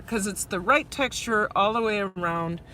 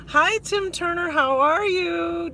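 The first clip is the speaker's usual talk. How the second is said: live-stream greeting voice; 7 semitones higher than usual. Shown as a demonstration. Welcoming a viewer with the shared livestream greeting cadence. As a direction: stretch the hello like a livestream host. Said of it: "Did you hear that?".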